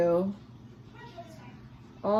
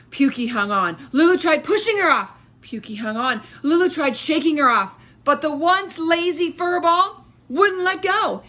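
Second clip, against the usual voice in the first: dramatic excitement; 6 semitones higher, 5 dB louder than usual. A narrator's reading. Dramatic struggle narration.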